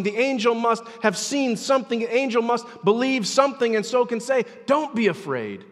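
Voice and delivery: high, loud pulpit exhortation; fervent, driving sermon voice; impassioned preaching. Acted. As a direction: urge the congregation with mounting force. Preaches at full force.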